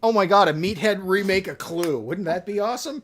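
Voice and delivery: high, loud gushing excitement; thrilled by the idea; excited. Real, not acted. Excited reaction to movie idea.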